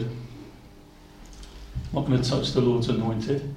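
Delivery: low, hushed and slow; quiet refusal. Retold or imitated. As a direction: voice David's restraint, very softly. David's acted refusal to kill Saul.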